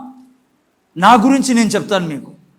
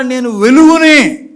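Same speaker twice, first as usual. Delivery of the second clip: slow, loud confession of faith; loud declaration; 7 dB louder, slower than usual. Acted. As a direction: proclaim it slowly like a battle cry. Preacher voices believer's declaration. (నెమ్మదిగా గట్టి ప్రకటన)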